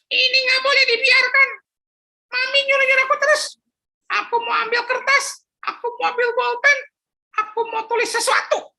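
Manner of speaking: put-on high childish grumble; a kid who has had enough; fed-up boy. Philip grumbling to himself about his mother, high child voice.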